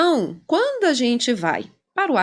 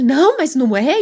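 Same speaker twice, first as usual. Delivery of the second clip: louder, mock objection; protesting client; 2 semitones higher, 6 dB louder than usual. Client objecting to the therapist's explanation, protesting. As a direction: push back briefly, lightly acted.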